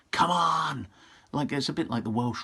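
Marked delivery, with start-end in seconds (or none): 0.1-0.9 loud, high battle cry; loud rallying call to charge; rallying shout. Retold or imitated. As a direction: rouse the troops.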